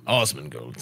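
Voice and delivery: "Osmongold" opening on a low Ah; Ah pronunciation. Shown as a demonstration. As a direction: say "Osmongold" deep, starting with Ah. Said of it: "So it's Ah".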